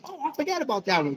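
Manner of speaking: mock surprise. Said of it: "you go".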